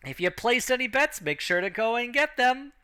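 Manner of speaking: high, projected announcer call; ring announcer. Acted. Announcer holding up the winner's paw, high ringing announcer voice. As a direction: present the winner to the arena. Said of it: "the announcer goes".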